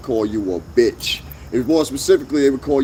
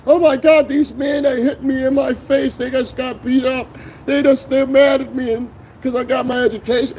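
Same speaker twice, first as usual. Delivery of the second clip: high-pitched mocking imitation of a distressed woman; mock victim woman; 12 semitones higher than usual. Host mimicking the woman who says men hit her, high mocking voice. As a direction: put on a high, whiny victim voice.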